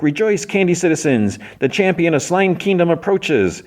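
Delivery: high, ringing mock proclamation; announcing princess. Princess addressing the crowd outside her castle, high proclamation. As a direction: proclaim it high, like a royal herald.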